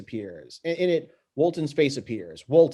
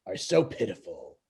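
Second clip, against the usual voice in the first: sinister villain; 7 semitones lower, 4 dB quieter than usual. Acted. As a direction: drag out the contempt. Koopa continuing.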